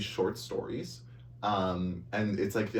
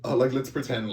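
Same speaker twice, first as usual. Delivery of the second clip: ordinary talk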